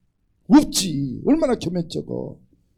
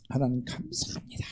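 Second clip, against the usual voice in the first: hushed thanks breathed out; whispered prayer; 6 semitones lower, 11 dB quieter than usual. Acted. Daniel thanking God in captivity, whispered. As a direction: pray it under your breath.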